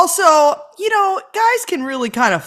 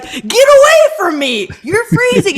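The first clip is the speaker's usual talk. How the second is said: high loud recoiling protest; high loud husband shoving away cold hands; mock recoil; 10 semitones higher, 3 dB louder than usual. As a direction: push her off, startled.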